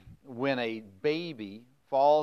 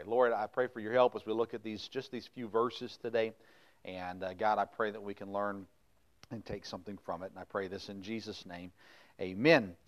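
quiet, softer prayer voice; 5 semitones lower, 5 dB quieter than usual. Acted. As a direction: pray humbly before the lesson. Pastor leads opening prayer.

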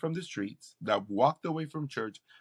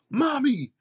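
much higher, louder, alarmed call; 13 semitones higher, 7 dB louder than usual. Retold or imitated. Son phoning his mom, alarmed and high. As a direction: blurt it out in disbelief.

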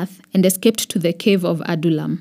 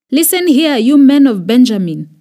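loud, high angry shout; 5 semitones higher, 6 dB louder than usual. A narrator's reading. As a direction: bark it at the officers like a furious king. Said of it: "Saul shouted to his officers".

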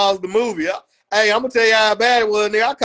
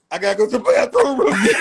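mock teary excuse. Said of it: "what you say, Ackland".

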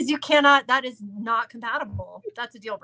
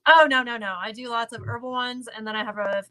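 ordinary talk

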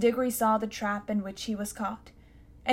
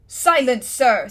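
bellowed, much louder; thundering command; 2 semitones higher, 10 dB louder, slower than usual. Acted. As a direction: shut the boy up. Uncle slams table, silencing boy.

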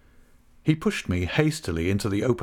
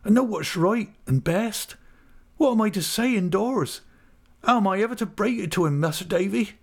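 high-pitched urgent rustic voice; agitated, pitched high; hurried, distressed countryman; 11 semitones higher than usual. Acted. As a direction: appeal anxiously for help. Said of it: "he said hurriedly".

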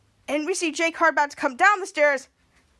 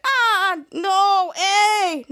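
higher, louder acted wail; mock cry of protest; 4 semitones higher, 4 dB louder, much slower than usual. Second muchacho being hugged, loud mock wail. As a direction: wail it in pretend horror.

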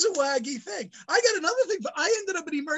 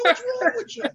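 much higher, furious mock tirade; retold angry outburst; 9 semitones higher than usual. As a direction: rant at the lab like you're fed up. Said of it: "I go".

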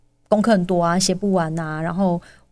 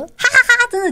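fast, louder mock laugh; retold child laugh; 5 dB louder, faster than usual. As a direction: imitate the child's quick giggle. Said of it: "他就开始笑". (快速模仿孩子哈哈笑)

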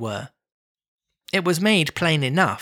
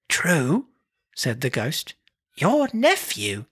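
a hint of the gentle ghost; slower than usual. Ghost speaks in reading.

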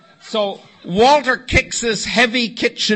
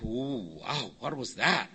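low, soft retold bewilderment; hushed, as if the storm just passed; stunned hush; 6 semitones lower, 9 dB quieter, slower than usual. Stunned residents after Walter storms off.

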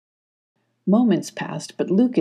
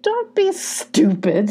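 slow, higher gruff villain line; Gruff's higher voice; 9 semitones higher, slower than usual. A narrator's reading. Gruff scolding his badgers, slow and higher. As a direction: scoff at the idea. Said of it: "said Gruff".

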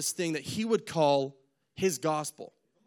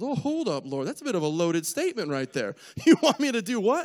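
incredulous balk; 4 semitones higher, 5 dB louder than usual. Acted. Ananias balks at God's command.

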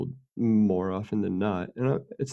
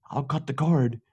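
threatening mom; 5 semitones higher than usual. Retold or imitated. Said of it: "she had a temper".

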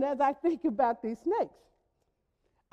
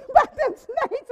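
laughing; 7 semitones higher, 4 dB louder than usual. Retold or imitated. Friend laughing at PTSD joke.

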